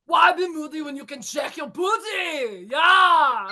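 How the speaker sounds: high-pitched mock Austrian Arnold voice; Arnold accent. Shown as a demonstration. Contestant trying the phrase again, Arnold accent. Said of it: "official Arnold accent achieved".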